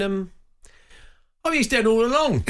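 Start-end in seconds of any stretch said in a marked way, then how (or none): 1.4-2.4 mocking spoiler. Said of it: "he's going".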